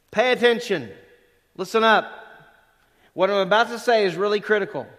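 calling for attention. Shown as a demonstration. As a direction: summon the room to attention. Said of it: "it would be".

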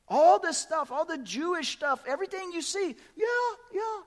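bubbling over with enthusiasm; preacher fired up about his Persian church; excited. Real, not acted.

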